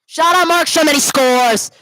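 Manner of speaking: loud, high shout as the puck goes in; real play-by-play goal shout; excited goal call. Real, not acted. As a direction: belt out the goal, pitch easing down.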